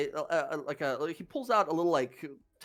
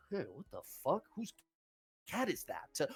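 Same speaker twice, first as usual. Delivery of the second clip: hushed guard; 9 dB quieter than usual. Acted. Guard notices strange cat.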